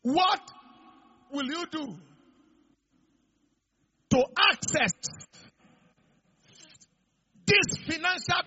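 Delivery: high, loud challenging question; loud challenge. Real, not acted. Preacher challenging listeners about the blessing, loud. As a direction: demand an answer from the room.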